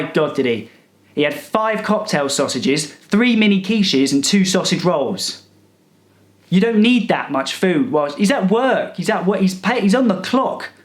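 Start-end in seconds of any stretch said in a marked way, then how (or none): none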